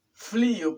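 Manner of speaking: "frío" said as "flío"; "frío" mispronounced with L replacing R; learner's L for R. Retold or imitated. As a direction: imitate the mistake, "frío" with L after F. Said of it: "no es frío con L".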